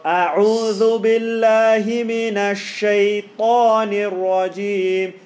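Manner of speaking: slow, loud chanted recitation; Quranic recitation. Shown as a demonstration. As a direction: recite with a big heavy sheen. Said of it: "Big sheen, guys".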